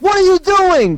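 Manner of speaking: wife's shriek. Retold or imitated. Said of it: "she's like".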